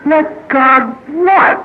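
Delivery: high, drawn-out bewildered question; baffled newcomer. Acted. Newcomer puzzling over a slogan he was given, baffled. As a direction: stretch it out high in total bafflement.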